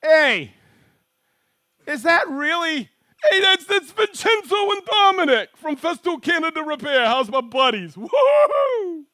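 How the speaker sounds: shouting greetings at friends, pitch way up; thrilled yell to buddies watching; real excited hollering. Real, not acted. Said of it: "I got all excited there".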